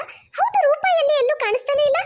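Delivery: excited loud greeting. Acted. Son back from trip, greets mother.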